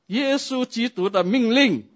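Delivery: high, loud, slow proclamation; proclaiming. Acted. Pastor to couple, proclaiming God's command, loud. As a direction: declare the command with force.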